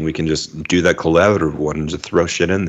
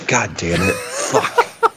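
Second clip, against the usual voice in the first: pained curse; 3 semitones higher, much slower than usual. Real, not acted. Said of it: "Oh, it's the zipper".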